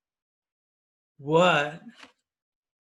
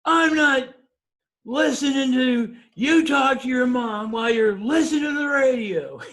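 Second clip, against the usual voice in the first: loud, high, fed-up retort; angry wife; 9 semitones higher, 6 dB louder than usual. Retold or imitated. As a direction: scold him loudly from the passenger seat. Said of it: "she says".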